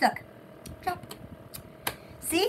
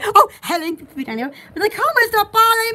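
ordinary talk

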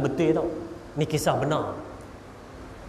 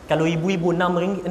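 usual speaking voice

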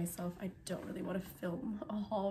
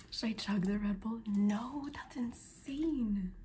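whispering; 2 semitones higher than usual. Real, not acted. Packing at midnight, whispering to the camera. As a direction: whisper so no one wakes.

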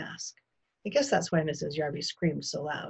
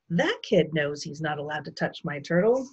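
ordinary delivery

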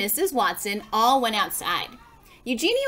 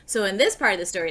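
plain reading voice

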